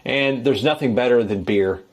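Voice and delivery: normal talking voice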